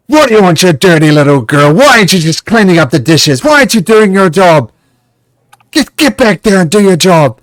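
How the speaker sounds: loud, high, furious scolding of a servant; screaming at the servant girl; angry yelling. Acted. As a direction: bark at her like a cruel master.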